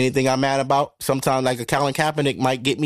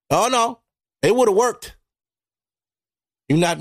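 ordinary talk, nothing marked

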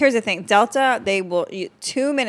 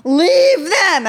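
mock outburst; 7 semitones higher, 3 dB louder, much slower than usual.

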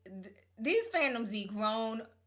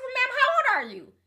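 mock incredulous; 13 semitones higher, 5 dB louder than usual. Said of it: "I said".

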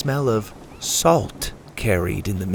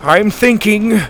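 higher, louder frantic answer; flustered shout; 8 semitones higher, 5 dB louder, slower than usual. A narrator's reading. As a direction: snap back while searching pockets. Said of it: "Grandpa shouted".

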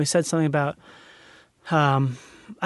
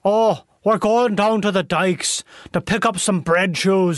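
slow, hammed-up Dutch accent; mock Dutch accent; slower than usual. Host riffing as an Amsterdam local, mock Dutch accent. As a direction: draw it out in a fake Dutch accent. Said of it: "Like a Dutch accent".

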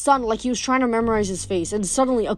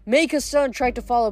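usual speaking voice

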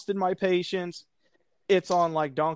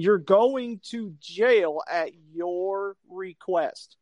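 slow explaining; slower than usual. Retold or imitated. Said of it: "explain this in a manner that you can understand".

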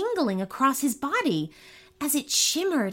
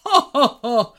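giants laughing; 3 semitones higher, 8 dB louder than usual. Acted. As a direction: laugh it out big and hearty. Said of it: "laughed the giants".

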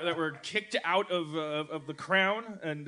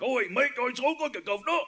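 mock Cockney; 8 semitones higher than usual. Comedian mocking fake Australians who slip into Cockney. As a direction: parody the wrong accent. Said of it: "they slip into Cockney".

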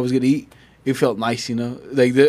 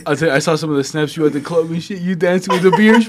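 normal talking voice

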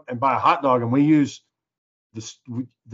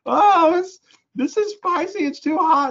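put-on very high, loud whiny kid wail; mock a child crying that it's too hot; squeaky hammed-up little kid voice; 5 dB louder, slower than usual. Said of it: "crying and shit".

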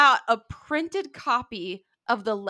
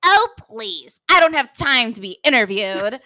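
loud, dismissive scoff; dismissive diva; 8 dB louder than usual. Retold or imitated. Neville brushing off the kids, loud bored scoff. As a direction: brush them off like a bored star. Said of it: "Neville goes".

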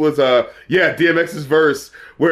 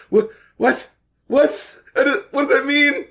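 rising disbelief, higher each time; more confused with every what; baffled; 9 semitones higher than usual. Real, not acted. Baffled by the lyric.

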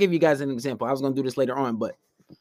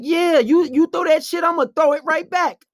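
heated comeback; 7 semitones higher than usual. Retold or imitated. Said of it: "I was like".

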